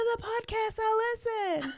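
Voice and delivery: very high-pitched imitated fan; retold insistent fan. Fan explaining herself to host, high insistent squeal.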